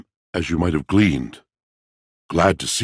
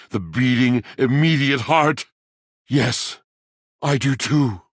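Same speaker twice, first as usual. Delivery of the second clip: higher, breathless and adoring; swooning romance; 5 semitones higher than usual. Acted. Confessing new love to the traveler, swooning higher. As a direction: melt into the moment of love.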